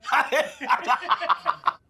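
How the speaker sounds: rapid staccato cackle; cackling laugh. Acted. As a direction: laugh at your own joke in quick bursts. Odd customer laughs at own remark.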